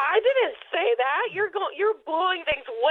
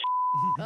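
bitter scoff of disbelief; scoffing laugh; 3 dB quieter than usual. Real, not acted.

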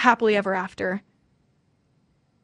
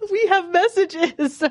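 laughing, high and drawn out; delighted laughter; 8 semitones higher than usual. Real, not acted. Host finds listeners' messages, delighted laughing. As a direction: giggle through the happy news.

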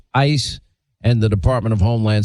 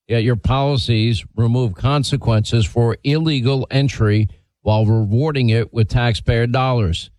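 ordinary talk

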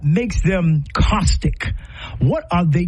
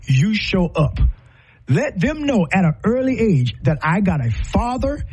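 normal talking voice

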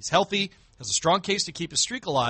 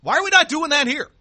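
exasperated disbelief; 10 semitones higher, 6 dB louder than usual. Real, not acted. Host mocks a seventh-place finish.

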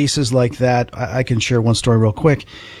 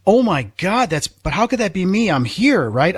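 higher-pitched, baffled disbelief; retold shocked disbelief; 7 semitones higher than usual. Storyteller reliving seeing their own body from outside, shocked disbelief. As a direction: reenact the shock with pitch leaping up.

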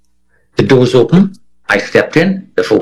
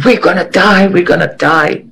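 mock panicked scream; 8 semitones higher than usual. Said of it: "Everybody is screaming".

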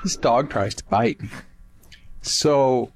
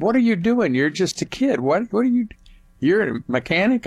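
higher-pitched, lightly voiced [name] quizzing a kid; imitating [name]; 8 semitones higher than usual. [name] quizzing the teenage filter boy, higher imitation. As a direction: raise the voice into a curious, amused [name].

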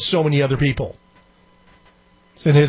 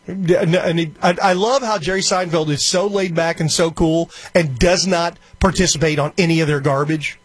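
ordinary delivery

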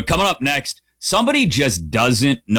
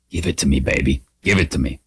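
leer it out low and creepy; 9 semitones lower, faster than usual. Pretend. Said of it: "I also get that creepy voice".

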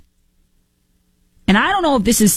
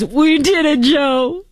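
high, joyful mock Kamala cheer; Kamala impression; 9 semitones higher, slower than usual. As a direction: cheer it like Kamala's viral call. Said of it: "Kamala Harris's".

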